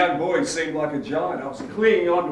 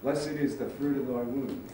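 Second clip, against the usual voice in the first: subdued praying; 5 semitones lower, 7 dB quieter than usual. Acted. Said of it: "In the midst of my terror".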